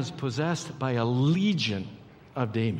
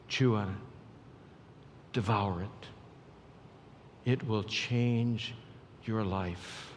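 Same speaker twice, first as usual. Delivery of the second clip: low, soft appeal with long pauses; hushed preaching; 5 semitones lower, 7 dB quieter, slower than usual. Real, not acted. Preacher urging the congregation not to take it for granted, hushed and low. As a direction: drop low and let each phrase land slowly.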